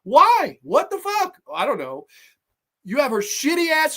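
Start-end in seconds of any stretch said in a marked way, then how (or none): 0.0-1.4 put-on high, baffled kid yelling in disbelief; mock bewildered kid squeal; amazed Macaulay Culkin. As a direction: shriek your confusion at the booby traps. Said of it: "been like".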